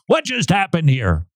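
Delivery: ordinary talk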